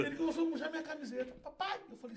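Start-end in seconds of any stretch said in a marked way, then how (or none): 1.4-1.8 put-on high, child-like plea; child's call. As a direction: call up like a tugging toddler. Son tugging father's shirt.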